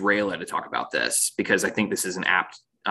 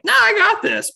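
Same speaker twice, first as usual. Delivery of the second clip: much higher, louder, breezy brush-off; cocky Rayla; 9 dB louder than usual. Retold or imitated. Podcaster voicing reckless Rayla waving off danger, cocky. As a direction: toss it off like nothing can hurt you.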